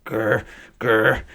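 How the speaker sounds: mouth-full spluttering growl; spluttered grr. A narrator's reading. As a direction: splutter through a sandwich. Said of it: "spluttered Missus Bird".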